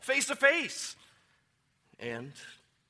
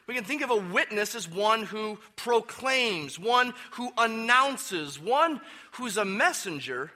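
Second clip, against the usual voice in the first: "proclaims", "announces" and "messenger" each leaned on, slowing at "one who announces"; emphasizing speech words; 4 semitones higher than usual. Shown as a demonstration. Pastor stressing the speaking words to his church, deliberate stress. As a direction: press each speaking word so the congregation hears it. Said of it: "you can hear what I'm emphasizing there".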